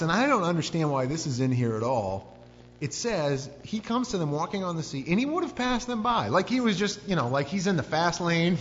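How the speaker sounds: ordinary talk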